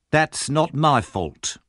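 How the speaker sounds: ordinary delivery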